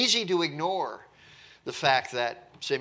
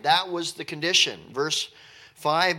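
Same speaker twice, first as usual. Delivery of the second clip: ordinary talk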